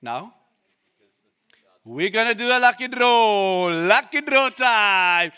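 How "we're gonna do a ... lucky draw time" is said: excited announcement. Real, not acted. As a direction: build up the lucky draw like a host. Announcing the lucky draw.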